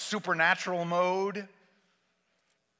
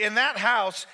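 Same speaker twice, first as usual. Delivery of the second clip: ordinary talk, nothing marked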